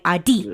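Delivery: "Audi" as short ah, hard DEE; overcorrected name. Retold or imitated. Coworkers still wrong, "Audi" chopped into ah-DEE. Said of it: "And they're like".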